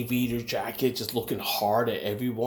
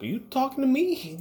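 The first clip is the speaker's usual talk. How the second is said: tough Brooklyn challenge, pitched way up; De Niro. Retold or imitated. Re-enacting his Taxi Driver moment to strangers, tough Brooklyn. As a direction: play up the movie tough guy, voice leaping high. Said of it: "I sounded like fucking De Niro".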